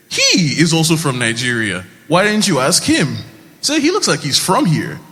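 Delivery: animated retort. Retold or imitated.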